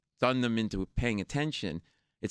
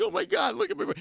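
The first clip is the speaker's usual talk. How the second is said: high-pitched mock panic; much higher voice, aghast; panicked girlfriend; faster than usual. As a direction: play an alarmed girlfriend at a bar fight. Voices the drunk man's panicking girlfriend.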